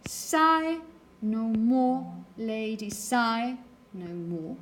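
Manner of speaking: slow reading that leans hard on each "sigh"; stressed-syllable rhythm. Shown as a demonstration. Teacher analysing a poem's stressed syllables, "sigh" drawn out in stressed rhythm. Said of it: "I'm interested in the stressed syllables".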